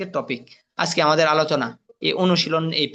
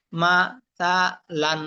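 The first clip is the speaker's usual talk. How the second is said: মা, সা, লান one syllable at a time; broken syllables; slower than usual. Shown as a demonstration. Teacher showing learners broken pronunciation, syllable by syllable. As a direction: separate মা, সা, লান with pauses. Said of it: "ভাঙ্গা উচ্চারণ করবেন একটা একটা করে".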